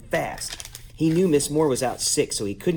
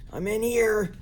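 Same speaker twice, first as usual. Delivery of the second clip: lightly voiced raspy wolf; a hint of rasp, pitched up; 10 semitones higher, slower than usual. A narrator's reading. Wolf in bed answers Mister Bundy, lightly raspy. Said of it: "said a raspy voice".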